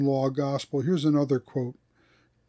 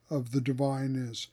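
normal talking voice

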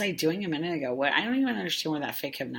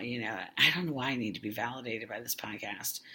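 ordinary talk

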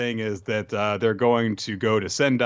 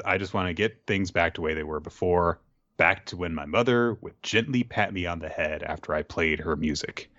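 wistful wish; 6 semitones lower than usual. Acted. Voices a character's wistful wish.

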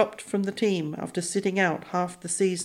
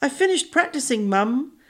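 put-on high, lightly clipped royal British accent; a hint of British royalty in the accent, pitched up; 7 semitones higher than usual. A narrator's reading. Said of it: "in the same accent as Tony's, but more clipped, like royalty".

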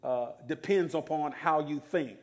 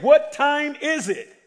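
slower and louder, pressing the crowd; louder repeat; 11 semitones higher, 9 dB louder, slower than usual. Shown as a demonstration. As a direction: ask again with more force. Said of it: "I said".

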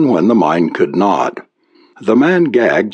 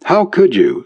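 higher, tearful reproach; sobbing mother; 9 semitones higher than usual. A narrator's reading. Mother reproaching her son, sobbing. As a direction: sob it out as a hurt mother. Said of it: "sobbed the mother".

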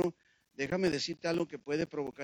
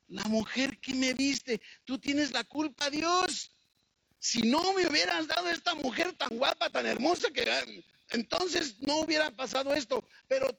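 whiny Adam; 8 semitones higher, 3 dB louder than usual. Retold or imitated. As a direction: plead like a caught husband passing the blame. Adam whines blaming God.

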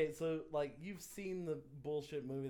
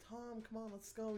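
put-on girlfriend; 8 semitones higher, 6 dB quieter, slower than usual. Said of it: "the girlfriend was like".

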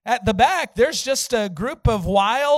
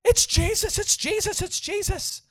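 high-pitched mock scream, held back in volume; imitated screaming; 11 semitones higher than usual. Man on the ground starts screaming, imitated high cries. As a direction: repeat it in a high, frantic squeal. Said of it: "he starts screaming".

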